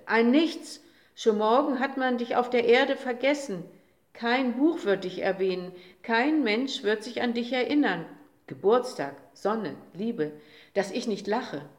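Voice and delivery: loud, mocking rant; scornful tirade. A narrator's reading. Uncle derides the little day before everyone, loud scornful rant. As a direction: sneer it out loudly, deriding him.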